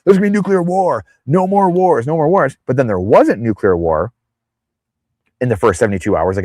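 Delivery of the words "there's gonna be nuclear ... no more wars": high, mock panicked warning; hammed-up alarmist chant. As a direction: parody the doom-sayers, pitched up. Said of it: "Bitcoin are saying".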